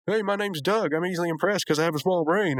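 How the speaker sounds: a high, goofy impression of Doug; bad impression of Doug. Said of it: "not a good impression at all".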